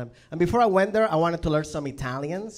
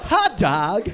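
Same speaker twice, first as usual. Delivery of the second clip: loud, higher, exaggerated American accent; hammed-up American vowels on "hot dog"; 5 semitones higher, 4 dB louder than usual. Comic showing off his American accent, loud and overdone. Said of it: "That's my American accent".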